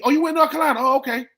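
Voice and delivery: telling the guys, the friend perks up in surprise; mock surprise. Retold friend realizing.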